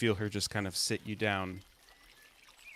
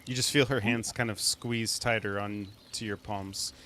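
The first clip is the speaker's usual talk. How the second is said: usual speaking voice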